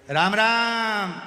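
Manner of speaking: long, loud, high-pitched holler; shouted greeting. Acted. Deaf visitor greeting a patient, shouting. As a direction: holler the greeting slowly, stretching it out. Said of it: "वो चिल्ला के बोलता है".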